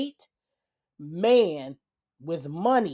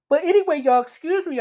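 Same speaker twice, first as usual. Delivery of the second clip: higher, louder, stretched by a real yawn; through a yawn; 9 semitones higher, 6 dB louder than usual. Real, not acted. Apologizing to viewers mid-yawn, yawning. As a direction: let a yawn pull the words up. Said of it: "I'm yawning".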